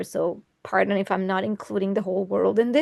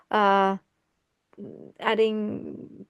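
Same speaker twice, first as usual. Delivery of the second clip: ordinary delivery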